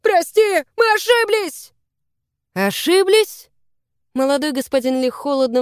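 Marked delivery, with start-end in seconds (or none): 0.0-1.7 high, loud plea for forgiveness; squealed, urgent apology; panicked pleading. A narrator's reading. Foxes beg their lord's pardon. 2.5-3.5 high, loud incredulous question; scandalised query, pitched way up; indignant echo. A narrator's reading. Lord throws their excuse back.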